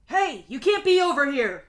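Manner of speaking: loud, high challenging yell. A narrator's reading. Scientist yelling at an intruder by the plant, loud yell. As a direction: call out sharply at a trespasser. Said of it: "Someone yells at you".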